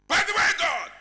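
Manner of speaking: high, very loud mock hail; calling out to God. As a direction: holler up to get God's attention. Mock caller addressing God.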